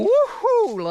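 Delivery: high, loud party whoop; a hammed-up wild cheer; excited whoop. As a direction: whoop it up like you're on fire. Acting out "hot" energy.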